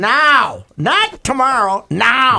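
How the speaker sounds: "now" punched high and loud, both times; "now" belted out as its own burst; stress on now. Real, not acted. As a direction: hit each "now" like a hard-sell command. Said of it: "had the word now for emphasis".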